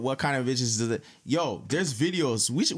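ordinary talk

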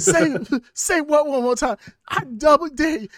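high, loud menacing yell; Pulp Fiction shout. Pretend. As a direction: scream the dare like an enraged hitman. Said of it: "going into a Pulp Fiction".

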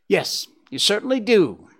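ordinary delivery

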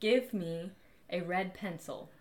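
slow, exaggerated mouthing; over enunciating. Shown as a demonstration. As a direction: stretch each word out slowly for lip reading. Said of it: "Start over".

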